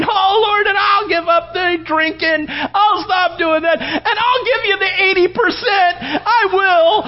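high, slow, shouting tearful bargain; desperate bargaining. Retold or imitated. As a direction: plead and bargain through sobs. Bargaining with God while swimming.

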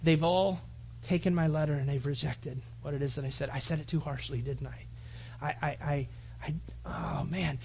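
quiet, low, acted dejection; sick with dread. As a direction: fret softly, stumbling into self-doubt. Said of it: "sick pit in his stomach".

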